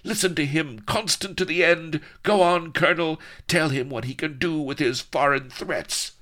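high, loud, defiant heroine; defiant cry. A narrator's reading. Vanity urges the colonel to resist, defiant. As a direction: ring out in proud defiance. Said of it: "cried Vanity".

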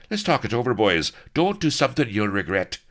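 high, loud stalling plea; urgent pleading. A narrator's reading. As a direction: talk the pirates out of it, quickly alarmed. Colonel pleading as he's being thrown overboard.

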